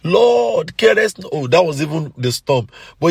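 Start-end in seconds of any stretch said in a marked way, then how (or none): 0.0-1.3 high, loud desperate cry to the Lord; shouted cry for help. Retold or imitated. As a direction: cry out as if drowning in the storm. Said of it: "He shouted".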